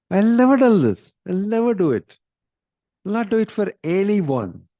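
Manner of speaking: high, loud cry of refusal; indignant refusal. Retold or imitated. King crying out to Mohini, high and indignant. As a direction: shout him down, nearly at a yell.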